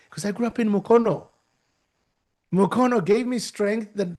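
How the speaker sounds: usual speaking voice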